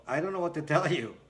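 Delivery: usual speaking voice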